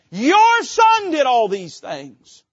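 "Your son" high and loud, slowed; stress on your. Retold or imitated. Older brother disowning his brother to the father, loud and slow. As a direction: drag out "Your son" with disdain. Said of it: "He's saying".

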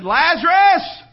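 loud, high, drawn-out holler; commanding shout, stretched long; hollered call. Acted. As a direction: holler a name into a tomb. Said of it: "he hollered out and he said".

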